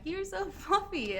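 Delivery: high, drawn-out coo through a little laugh. Retold or imitated. Farm kid fussing over cattle, cooing. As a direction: coo it high and slow at a fluffy animal.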